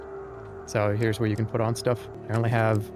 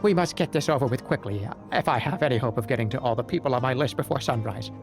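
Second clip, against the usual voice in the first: goblin voice; 3 semitones higher than usual. Acted. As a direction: play a scheming little goblin. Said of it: "goblin speaks, voiced".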